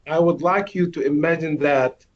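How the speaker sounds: usual speaking voice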